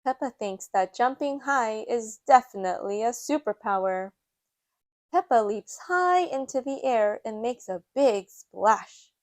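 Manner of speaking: usual speaking voice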